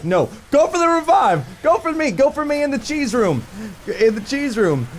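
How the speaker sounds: high loud desperate shouting; frantic loud call; yelling. Retold or imitated. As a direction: yell at the teammate to revive. Yelling at teammate.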